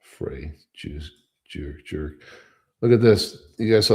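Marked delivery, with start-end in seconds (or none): 0.0-2.1 much softer and lower, under the breath; muttered insult. Real, not acted. Host muttering insults at a politician. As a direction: mutter it to yourself.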